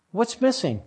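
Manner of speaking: normal talking voice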